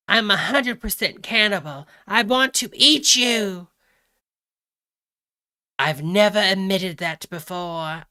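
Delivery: loud mock-Cockney confession; hammed-up British Cockney cannibal. Reading the cannibal text to followers, loud mock British Cockney. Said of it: "he's reading it British Cockney".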